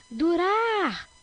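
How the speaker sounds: durar called out, final RAR long and high; stress on RAR. Shown as a demonstration. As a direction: stretch and lift the RAR in "Durar". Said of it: "é orar". (durar com o RAR final bem alongado)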